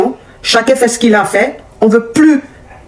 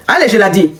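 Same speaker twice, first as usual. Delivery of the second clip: usual speaking voice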